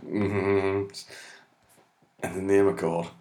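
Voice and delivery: usual speaking voice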